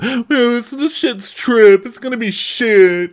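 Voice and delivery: hammed-up chef fed up; mock whiny chef. Podcaster imitating chef moaning in laundry, high and loud. [name] voiced grumbling.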